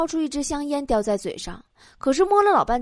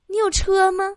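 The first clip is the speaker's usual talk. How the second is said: woman on blind date; 6 semitones higher than usual. Acted. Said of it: "女的问我".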